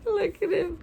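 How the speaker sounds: high-pitched laughing words; real giggling. Real, not acted. Reader amused by a picture, giggling. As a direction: talk through a fit of giggles.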